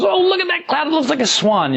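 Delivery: high, excited voice pointing at the sky; delighted cloud-watcher. Pretend. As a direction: squeal it out like a thrilled friend. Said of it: "someone points up and goes".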